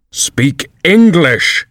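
very slow, high cheeky eaglet; a bold young bird cutting in; blunt eaglet. A narrator's reading. Eaglet retorts.

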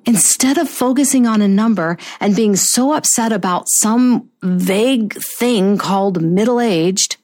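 ordinary talk, nothing marked

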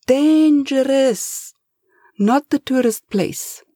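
put-on Spanish-accented, desperate plea, slow; pleading Spanish accent. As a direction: beg the boss in a Spanish accent. Eustasio confesses.

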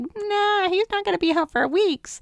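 high, breezy voice of the locals; mock dismissive. Voicing everybody's breezy reply.

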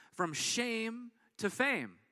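slow, deliberate title read. Shown as a demonstration. Pastor announcing the sermon title slowly. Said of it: "and it's titled this".

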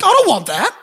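high, loud mock outrage; indignant protest. Preacher retells refusing to buy someone a car, indignantly. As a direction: balk loudly, pitch leaping up. Retells balking at God's request.

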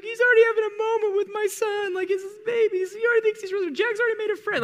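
very high, strained imitation of crying wife; teary wife. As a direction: mimic a wife sobbing over her newborn. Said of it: "she starts, like, crying".

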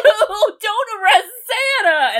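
put-on high-pitched wail of a pleading kid; imitated tearful crowd of kids; mock crying children. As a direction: plead like upset children, hammed up. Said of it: "they start crying".

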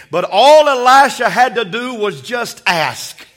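usual speaking voice

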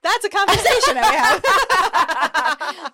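slow, high words through laughter; laughing. Real, not acted. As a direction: laugh while you say it.

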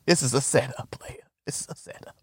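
urgent secret whisper; a friend warning him, whispered. Retold or imitated. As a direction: breathe the warning under your breath. Warning voiced in retold scene.

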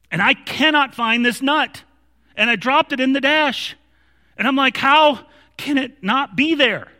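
high, loud exasperated rant; mad, retold. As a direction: re-enact losing your temper over a tiny thing. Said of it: "it's stupid how mad I'm getting".

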